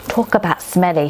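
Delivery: usual speaking voice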